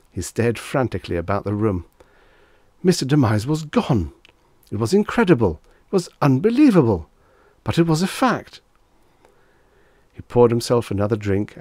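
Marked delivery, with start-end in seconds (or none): 2.8-8.6 high, loud, mounting amazement; astonished disbelief. A narrator's reading. Narrator telling that Mister Demise was gone, astonished. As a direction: hit each statement higher, incredulous.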